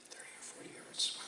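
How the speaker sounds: an unvoiced, amazed aside to himself; hushed whisper. Real, not acted. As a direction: breathe it out in an awed whisper. Awed aside on the distance.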